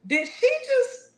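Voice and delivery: can't believe the prayer; high outraged disbelief. Acted.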